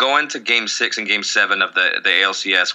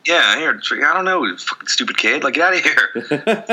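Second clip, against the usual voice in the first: mock angry boss; 6 semitones higher than usual. Acting out ice cream shop scene.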